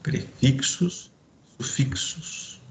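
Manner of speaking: "prefixos, sufixos" stretched slowly, the second fading; drawn-out terms. Shown as a demonstration. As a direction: slowly announce the two terms. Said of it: "nessa parte de".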